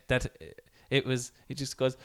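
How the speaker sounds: ordinary delivery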